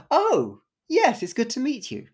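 high, loud burst of delighted surprise; sudden loud, high-pitched welcome; delighted recognition. Retold or imitated. Akito greets the artist after a whispered secret, delighted recognition.

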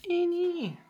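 high sing-song pet call. Real, not acted. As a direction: coax the cat in a high voice. Calling the cat.

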